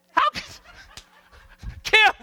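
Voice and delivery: high, loud laughing outcry; cracking up mid-call. Real, not acted. Slide lost, cracks up.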